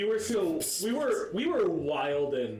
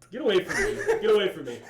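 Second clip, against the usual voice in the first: mock recoil; 6 semitones higher, 9 dB louder, much faster than usual. Recoiling from the ASMR voice.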